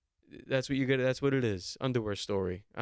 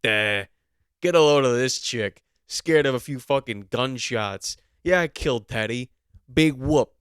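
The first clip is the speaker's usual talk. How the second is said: loud, dopey mock cop; dumb cop voice; 8 dB louder than usual. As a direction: sneer it out like a dim, smug cop. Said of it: "he's just like".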